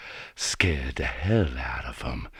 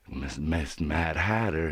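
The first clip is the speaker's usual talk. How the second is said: ordinary delivery, nothing marked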